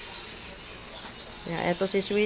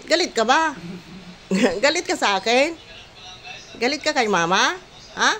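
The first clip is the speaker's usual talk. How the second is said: higher, sing-song coaxing voice throughout; 5 semitones higher than usual. Real, not acted. Caregiver cooing at elderly Sweetie, higher sing-song baby talk.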